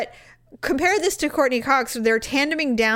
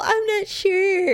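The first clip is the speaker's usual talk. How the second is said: mock hesitant whine; 7 semitones higher, slower than usual. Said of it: "Don't be all".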